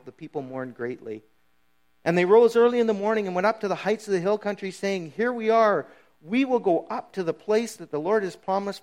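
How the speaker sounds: reading the passage out, rising to a ringing call; scripture reading. Acted. Preacher reads Numbers to the church, building emphasis. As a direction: read Scripture to the congregation with growing force.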